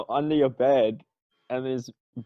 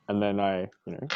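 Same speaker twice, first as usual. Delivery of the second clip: ordinary talk, nothing marked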